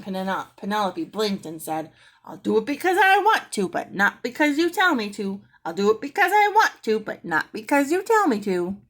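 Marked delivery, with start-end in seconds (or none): 2.2-8.8 high, loud parrot chant; a parrot sassing Mary at bedtime; sassy parrot. A narrator's reading. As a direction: rattle it off like a smug bird. Parrot repeats its catchphrase.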